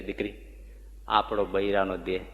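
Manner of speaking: tender comfort. Retold or imitated. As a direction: hush down and console her gently. Surajba comforts Dhanbai.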